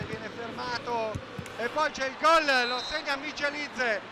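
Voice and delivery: ordinary delivery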